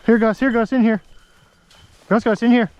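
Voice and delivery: high, loud call to Gus; calling the dog. Real, not acted. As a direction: call the dog over from a distance.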